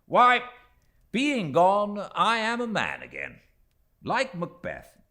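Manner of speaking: high, loud, mock-grand recital; a young gentleman playing the tragedian; theatrical declaiming. A narrator's reading. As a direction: ham it up like a stage actor quoting Macbeth. Steerforth declaims, shaking off gloom.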